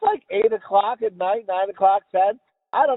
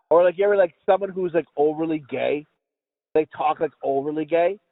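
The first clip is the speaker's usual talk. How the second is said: ordinary talk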